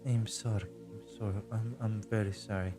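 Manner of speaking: nervous apology. Acted. GM voices anxious girl apologizing.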